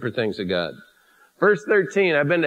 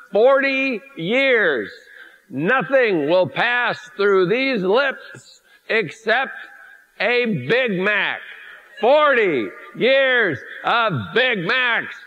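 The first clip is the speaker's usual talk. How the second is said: slow emphatic; 5 semitones higher, much slower than usual. Acted. Exaggerating forty years of Big Macs.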